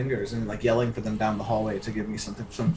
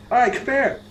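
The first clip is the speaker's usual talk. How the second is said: bossy call; 13 semitones higher, 7 dB louder than usual. Acted. Sykes snapping at servants.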